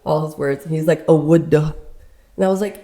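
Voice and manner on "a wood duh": loud imitated Cajun, ending swallowed; Cajun accent. Cajun hunter repeats himself to confused listeners, Cajun accent. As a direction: mimic a Cajun's dropped final consonant. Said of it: "he's so Cajun when he talks".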